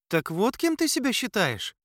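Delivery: high, startled question; surprised. Acted. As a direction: caught off guard, voice jumps up. Surprised reply to Aladdin.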